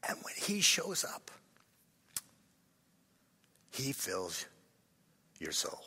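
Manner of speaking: soft, breath-filled, long pauses; hushed meditation. Shown as a demonstration. Preacher closing the breathing prayer, hushed meditative voice.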